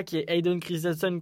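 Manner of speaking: ordinary talk, nothing marked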